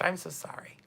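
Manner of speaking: soft whisper, almost off mic; hushed aside whisper; whispered apology. Real, not acted. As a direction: breathe the apology under your breath.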